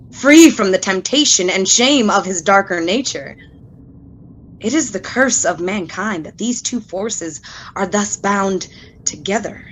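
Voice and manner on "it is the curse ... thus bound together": normal talking voice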